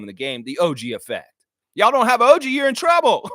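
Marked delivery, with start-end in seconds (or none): 1.7-3.3 higher and louder, all-in fan taunt; real hype yell at New York fans; fired-up shout. Real, not acted. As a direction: call it out to the rival fans. Fired-up fan calling out rivals.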